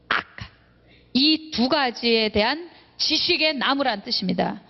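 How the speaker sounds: ordinary talk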